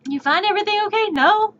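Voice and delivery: fast, high mock cashier greeting; tired bored cashier. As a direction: rattle off the greeting like a bored clerk. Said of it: "repeating cashier bit".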